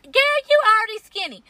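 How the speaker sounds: high mock scoff; dismissive friend. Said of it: "they'll be like".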